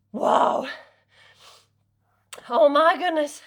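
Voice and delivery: breathless after effort; gasping relief after a hard set; out of breath. Real, not acted. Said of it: "That was super challenging".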